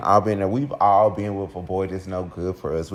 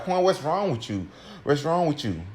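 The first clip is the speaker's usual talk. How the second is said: higher, alarmed retold question; worried questioning; 6 semitones higher than usual. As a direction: plead to know what happened.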